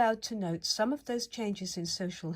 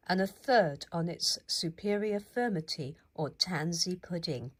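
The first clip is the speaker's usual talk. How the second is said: plain reading voice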